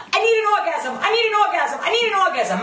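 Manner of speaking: high, repetitive mimicry of a needy woman; mock whining plea. Translating what Renee is really saying, mock high whine. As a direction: whine it like a hammed-up chant. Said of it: "what you're really saying is".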